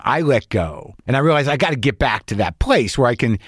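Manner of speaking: ordinary delivery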